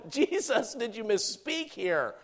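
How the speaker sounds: high, incredulous question; acted-out astonishment; shocked disbelief. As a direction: question it in mock disbelief, drawn out at the end. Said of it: "it's a shocking message".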